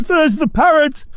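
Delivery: very high delighted shout; excited cry. Acted. Scrooge spotting the parrot in his vision, crying out. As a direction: point and cry out with glee. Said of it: "cried Scrooge".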